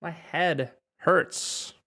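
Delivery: My head hurts said at half speed, each word clear; slow demo. Shown as a demonstration. Teacher modelling My head hurts slowly for Spanish speakers. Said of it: "Me duele la cabeza".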